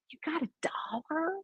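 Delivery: hushed whispered question; imitated sly whisper. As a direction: slip it in under your breath, as Mom. Mother sneakily asking for dollar.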